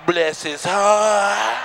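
drawn-out, slow church-lady pity; slow pitying grandma. Retold or imitated. Grandma in church pities an ugly baby, drawn out slow. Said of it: "Your grandma walk from nowhere".

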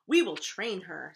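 loud, emphatic declaration; resolute king. A narrator's reading. King agreeing to train the princess, emphatic declaring. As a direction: declare it firmly and loudly.

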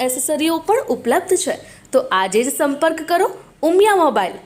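punchy sales pitch with lifted, swinging intonation; ad read. Acted. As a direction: sell it like a local shop commercial, pushing the key names. Reads a mobile shop advert. (જાહેરાતની જોશભરી શૈલી)

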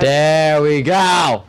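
booming into the mic; slow, loud and booming. Real, not acted. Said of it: "Whoa, that's loud".

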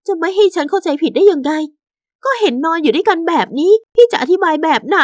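high-pitched angry confrontation; indignant wife. Acted. Wife found husband with another woman, angrily confronting.

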